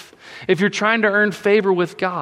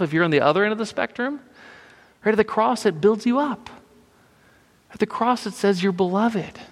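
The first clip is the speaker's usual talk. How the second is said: ordinary talk, nothing marked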